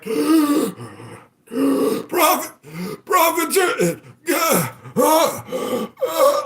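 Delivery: very high, strained stammering panic; mock choking panic. Company struggling for breath over profits, hammed-up panic. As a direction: choke out the words in terror.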